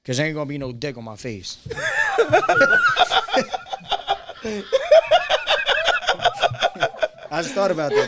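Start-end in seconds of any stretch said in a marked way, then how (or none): 1.6-7.3 long high-pitched cackle; long high laugh. Real, not acted. Cracking up at own joke.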